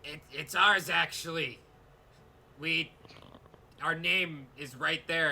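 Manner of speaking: a pushy little goblin insisting; indignant goblin claiming the land. Acted.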